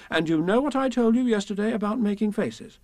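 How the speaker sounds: high, firm scolding mother; severe Kanga. A narrator's reading. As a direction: warn the child sternly. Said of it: "said Kanga rather severely".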